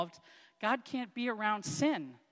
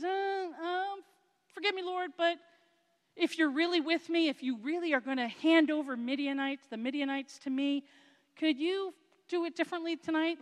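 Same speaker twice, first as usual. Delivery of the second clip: hesitant, pleading, pitched much higher; a nervous man asking one more favour, voice up high; nervous Gideon; 7 semitones higher than usual. Retold or imitated. Preacher voicing Gideon's nervous plea to the Lord, high and hesitant. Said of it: "But he's still so nervous".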